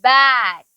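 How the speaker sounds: बैट drawn out slowly, falling in pitch; pronunciation demo. Shown as a demonstration. Teacher models बैट for the class, pronunciation demo. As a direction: say बैट low with a long, clear vowel. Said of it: "कैसे बोलेंगे?".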